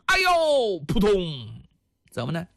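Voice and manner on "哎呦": 猛然拔高的一声痛呼; 摔倒时脱口而出的惊叫; 突然惊叫. A narrator's reading. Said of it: "突然间大叫一声". (高声惊呼)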